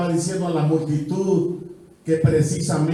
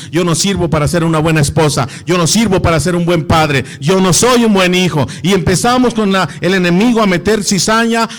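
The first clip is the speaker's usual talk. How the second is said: loud, driving sermon delivery; fiery preaching; 11 dB louder than usual. Acted. Preacher pounding doubts at the crowd, loud. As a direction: hammer each line at the congregation.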